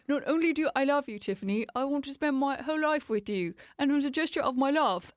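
stilted, badly acted love declaration; Sam from Made in Chelsea. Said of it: "really badly staged. So they all talk really, really poorly".

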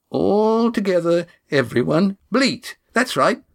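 mock them as sheep. Cam mocking sheep herding.

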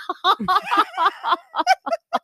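high-pitched laughing fit; helpless laughter. Real, not acted. Can't even say "give up alcohol", laughing helplessly. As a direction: crack up at your own words. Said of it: "can't even say it without laughing".

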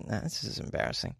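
breathy embarrassed whisper. Real, not acted. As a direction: whisper it under your breath. Embarrassed aside.

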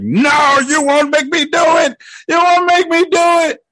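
high, loud, desperate yelling; frantic shout. Retold or imitated. Mark fighting himself, high frantic shout. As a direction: scream it at yourself in panic. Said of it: "slapping the shit out of himself".

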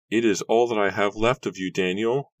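high mournful wail; lightly wailed grief. Acted. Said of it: "Nora wailed".

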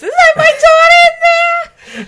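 hammed-up grieving father yell; over-the-top Sean Penn. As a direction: bellow it like an Oscar clip. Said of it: "five full minutes".

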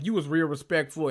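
ordinary delivery

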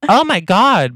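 high, swooping oh my God; feigned shock. Acted. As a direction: act scandalised to cover delight. Voiced line hiding true thought.

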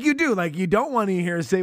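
normal talking voice

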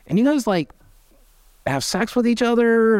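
high, disbelieving question; incredulous. Retold or imitated. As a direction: ask it in disbelief.

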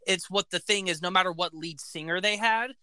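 ordinary talk, nothing marked

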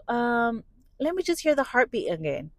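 lightly acted, hedging request; imitating ultrasound tech. Voicing the ultrasound tech, covering up.

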